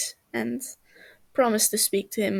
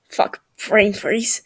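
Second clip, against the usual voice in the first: hissed in pain; 7 dB louder, slower than usual. Acted. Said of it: "he hisses in pain".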